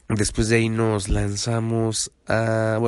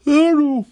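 sudden loud yawn; 8 dB louder than usual. Real, not acted. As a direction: yawn breaking into the words. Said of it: "este va a ser el podcast de los bostezos". (bostezo fuerte)

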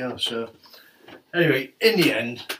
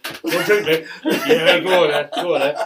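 acting out the bar routine, laughing and louder; 5 semitones higher, 8 dB louder than usual. Replaying the wine bar offer to the lads, laughing. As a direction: play both sides of the round, grinning through it.

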